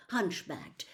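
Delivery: usual speaking voice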